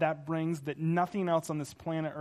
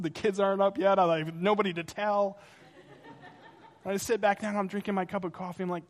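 ordinary talk, nothing marked

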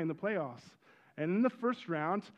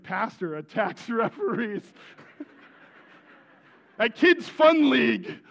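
mock headline; 3 semitones higher, 9 dB louder than usual. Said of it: "they were like".

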